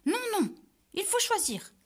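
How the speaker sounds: insist, refusing to back down. Acted. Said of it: "insistent les trois chanteurs". (insistance aiguë et forte)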